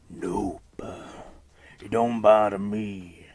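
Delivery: put-on slow, drawn-out creepy kid voice; confident creepy child. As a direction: reassure dad in a weird, creepy drawl. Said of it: "the child says this confidently".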